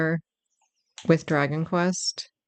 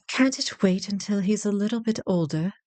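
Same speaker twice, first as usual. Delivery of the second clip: game character's plea; 5 semitones higher than usual. Acted.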